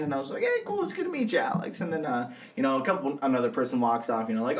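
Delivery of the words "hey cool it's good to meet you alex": much higher, bright-eyed hello; fake-cheerful host greeting; a lot higher, hammed-up friendly MC hello; chirpy greeting. Retold own friendly greeting.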